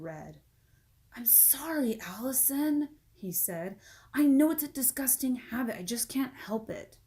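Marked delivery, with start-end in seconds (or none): 1.2-2.9 whispered, embarrassed apology; ashamed little whisper; sheepish whisper. Acted. 4.1-7.0 put-on embarrassed kid admitting a habit; higher, sheepish child voice; lightly voiced boy. Jason voiced by narrator.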